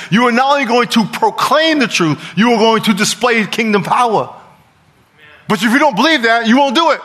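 high, loud, driving sermon delivery; preaching at full force. Real, not acted. Preacher urging congregation to do kingdom works, full-force preaching. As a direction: preach it hard, pitch up.

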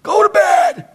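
put-on high, loud bark at a child; father snapping at his son at bedtime; angry shout at son. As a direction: yell it like a tired dad losing patience. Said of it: "I raise my voice".

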